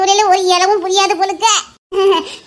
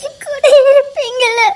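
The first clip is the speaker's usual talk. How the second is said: louder, breaking into sobs; sobbing plea; 2 semitones higher, 3 dB louder than usual. Acted. Wife sobbing to her husband for reassurance. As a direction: let the fear spill out crying.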